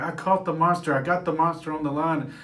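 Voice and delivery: high, loud and thrilled; excited boy. Retold or imitated. Boy shouting to his dad about the monster, high and loud. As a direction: burst out with the big catch. Boy hooks the big carp.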